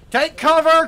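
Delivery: loud, high warning shout; urgent shout. Real, not acted. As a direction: yell it to warn the squad. Warning of incoming rockets.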